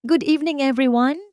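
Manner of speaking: high, loud greeting that fills the hall; bright ringing welcome to the crowd. A narrator's reading. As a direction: call out to the whole room. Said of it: "ay umalingaw-ngaw sa bawat sulok ng bulwagan".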